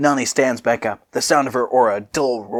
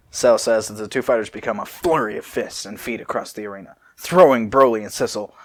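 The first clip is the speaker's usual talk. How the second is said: normal talking voice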